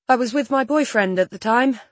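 loud, self-assured statement; confident. A narrator's reading. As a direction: say it with borrowed confidence. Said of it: "an imitation of Don's natural confidence".

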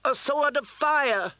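high, slow triumphant shout. A narrator's reading. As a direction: cry out in triumph. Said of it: "shouted Queen Selyse".

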